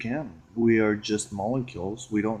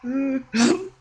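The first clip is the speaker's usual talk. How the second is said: high, loud laughing squeal; high shrieking giggle; 7 dB louder than usual. Real, not acted. Shrieking laugh after creepy.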